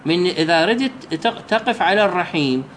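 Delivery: usual speaking voice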